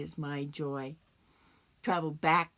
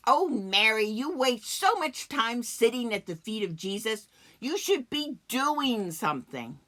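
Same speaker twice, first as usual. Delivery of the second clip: louder, higher nagging sister; scolding Martha; 4 semitones higher, 5 dB louder than usual. Acted. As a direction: scold your sister for wasting time. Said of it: "scold me for being wasteful, like Martha".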